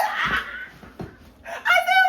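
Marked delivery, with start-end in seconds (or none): 0.0-0.4 short high scream of shock. Retold or imitated. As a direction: let out a startled yelp.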